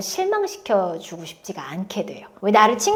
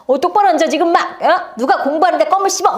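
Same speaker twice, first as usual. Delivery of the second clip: higher, louder scolding teacher; mock-scold a slouching student; a hint of a stern teacher nagging; 5 semitones higher, 4 dB louder than usual. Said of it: "이런 대우만 너무 많이 받았단 말이야".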